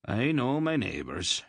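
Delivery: usual speaking voice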